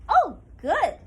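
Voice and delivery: delighted squirrel. Acted. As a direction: cheer with relief. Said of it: "said Susan Squirrel".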